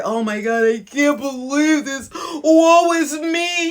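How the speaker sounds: over-the-top woe-is-me wail; mock whining cry. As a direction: play the self-pitying victim with a fake sob. Mocking self-pitying failure whine.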